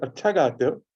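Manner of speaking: retold praise, lifted in pitch; quoting an elder poet. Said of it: "Faiz looks at me and he said".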